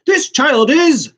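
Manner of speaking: high, slow, loud hero comeback; hammed-up heroic one-liner; heroic retort. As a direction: deliver it like a mock action hero.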